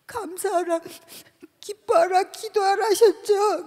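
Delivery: a tearful protest to God, voice breaking; crying plea. Retold or imitated. Pleading tearfully with God at the prayer retreat.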